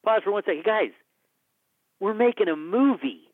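high, loud call to the whole crew; hyped director rallying his crew on set. Retold or imitated. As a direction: gather everyone round and lift their spirits. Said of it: "I go".

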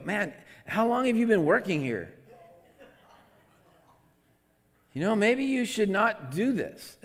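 hammed-up snide scolding; mock condescending. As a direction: talk down to the clerk. Rude words to grocery clerk.

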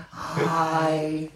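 long-held greeting, celebrity impression; Jennifer Coolidge. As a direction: stretch the greeting out like the actress. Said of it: "Jennifer Coolidge".

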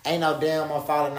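ordinary talk

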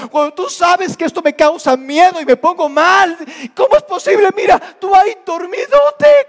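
rising from hurt grumbling to an anguished yell at Jesus; frightened disciples' protest. Acted. Disciples protest to a sleeping Jesus, building to an anguished shout.

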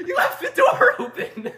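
shrieky falsetto yell breaking into laughter; mock screaming. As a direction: scream after him in hammed-up panic, laughing. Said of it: "he's screaming. And we're like".

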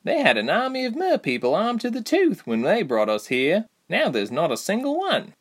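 put-on young mermaid voice. A narrator's reading. Reshma voiced by narrator.

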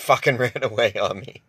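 usual speaking voice

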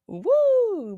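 a high excited woo; excited whoop. Real, not acted. Vlogger hyping herself for viewers, excited high whoop. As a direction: whoop like you're hyping yourself up. Excited about looking spicy.

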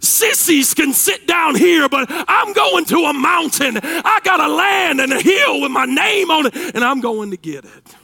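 loud, high, driving sermon shout; full-force preaching. Real, not acted. Preacher urging congregation to move by faith, full-force shout.

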